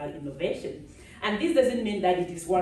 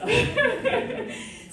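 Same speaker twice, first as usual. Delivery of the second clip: light high laugh; laughing about her accent; 3 dB louder than usual. Real, not acted.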